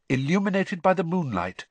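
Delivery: ordinary delivery, nothing marked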